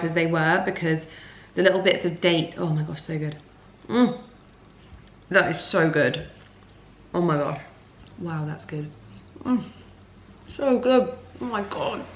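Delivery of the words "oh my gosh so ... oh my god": soft, relishing murmur; blissed-out tasting; savouring. Real, not acted.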